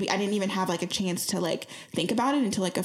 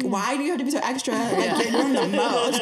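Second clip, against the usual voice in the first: mock-annoyed, giggling; laughing exasperation; 4 semitones higher, 4 dB louder than usual. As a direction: tease him for being extra while laughing. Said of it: "I'm like".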